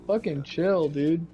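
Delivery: ordinary delivery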